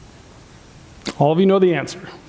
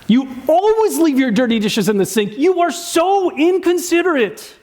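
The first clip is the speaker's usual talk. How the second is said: exasperated partner scolding, pitched way up; accusing spouse; 8 semitones higher than usual. Acted. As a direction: nag about the dishes in disbelief.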